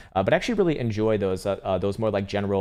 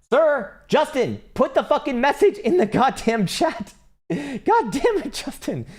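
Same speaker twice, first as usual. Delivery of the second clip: high, loud, slow mock-exasperated yell; 3 dB louder, slower than usual. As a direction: bellow at Justin, hammed up. Yelling at chatter Justin.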